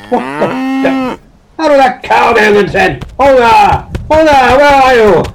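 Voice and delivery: high, very loud outraged yell; alarmed shouting. Acted. Colonel waking to find tent gone, alarmed shouting. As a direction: shout out in startled indignation.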